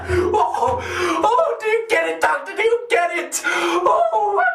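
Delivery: high, giddy words through laughter; manic laughing. Acted. Madman asking the doctor about his punchline, laughing giddily. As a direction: gasp it out, helpless at your own joke. Cracks up at own joke.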